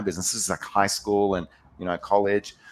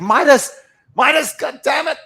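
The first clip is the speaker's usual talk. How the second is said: high, loud exasperated shout of the name; yelling at the cat; 9 dB louder than usual. Retold or imitated. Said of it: "You're like".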